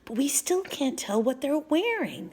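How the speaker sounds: high, breath-heavy whisper; hushed whisper. A narrator's reading. Annie to Jack while hiding near soldiers, whispered.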